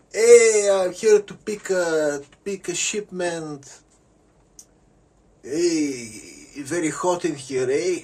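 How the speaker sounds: hammed-up Canadian pretender; disguised villain posing as Canadian, high and loud; fake Canadian. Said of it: "He's pretending to be Canadian".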